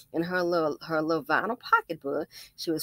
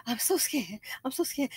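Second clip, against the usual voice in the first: fake scared; 6 semitones higher, 4 dB quieter than usual. Imitating old woman again.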